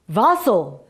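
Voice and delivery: put-on loud Russian-accented peasant; Russian accent, forceful. A narrator's reading. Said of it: "in a Russian accent".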